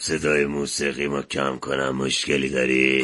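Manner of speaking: low, rough street tough; tough guy voice. Acted. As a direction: pick a fight, low and slow. Tough guy rebuffs a passenger.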